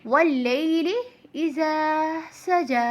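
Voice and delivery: slow chant, "इज़ा" and "सजा" ending on long held आ; lengthened recitation. Shown as a demonstration. Teacher models the verse, melodic with long endings on "इज़ा" and "सजा". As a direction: recite "वल्लैलि इज़ा सजा" slowly, stretching both final vowels. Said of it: "ऐसे".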